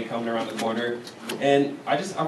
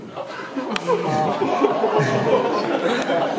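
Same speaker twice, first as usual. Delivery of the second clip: crowd bursts out laughing; crowd laughter; 8 semitones higher than usual. Real, not acted. Crowd laughing after joke.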